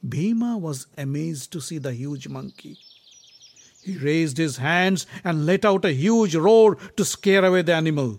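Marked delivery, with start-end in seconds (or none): 3.8-8.2 loud dramatic narration. A narrator's reading. Narrating huge roar.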